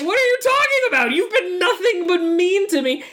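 squeaky, hurt mock girl voice; high mock whine. Friend acting out Margie's bad movie line, high mock whine. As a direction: whine it up high like a wounded teen.

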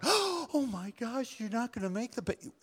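high mock gasp of a shocked listener; shocked congregant. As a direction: play a scandalised churchgoer, high and breathless. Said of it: "some of you out here are going".

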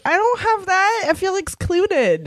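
mock sulky. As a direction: whine it with a pout. Mock sulk at being left out.

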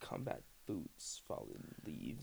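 slow, low breathy whisper; whispered lyric echo. Retold or imitated. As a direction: breathe the words out slowly.

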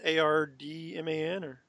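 "A R D M A N" as separate letters, questioning; spelling it out. Shown as a demonstration. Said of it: "want people to hear that clearly".

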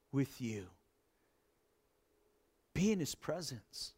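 soft, intimate pastor's appeal; hushed altar call. Acted. Pastor speaking softly to viewers at home, hushed appeal. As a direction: draw the listener in gently.

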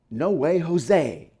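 slow, laid-back cool-guy read; lightly voiced cool dude; cool-guy slang. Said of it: "if you're really cool, you would read it as".

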